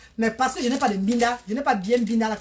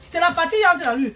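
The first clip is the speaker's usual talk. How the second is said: emphatic repeat; 6 semitones higher, 4 dB louder than usual. Real, not acted. Repeats the line emphatically to viewers.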